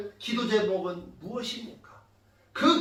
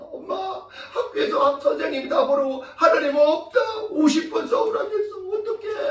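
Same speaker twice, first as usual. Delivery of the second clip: put-on high-pitched, whimpering child voice; distressed child; 8 semitones higher than usual. Child telling mother about homework, imitated distressed whine. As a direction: imitate a child wailing to mum. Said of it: "하고 막 이제".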